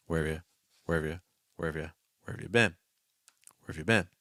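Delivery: "Where have you" flowing vowel to vowel, quick; weak consonants. Shown as a demonstration. Teacher drills students, "have you" with weak consonants. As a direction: soften consonants in "have you", land on "been". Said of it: "See how weak the consonant sounds are".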